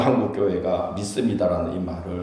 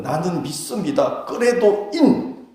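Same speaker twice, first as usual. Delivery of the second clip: early believers' confession; 7 semitones higher, slower than usual. Retold or imitated. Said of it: "초대교회 성도들은".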